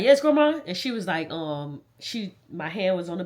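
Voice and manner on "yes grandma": high, loud, eager-to-answer reply; answering a call. Retold or imitated. Granddaughter at the bedside, answering grandma's call. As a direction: answer up high as if called over.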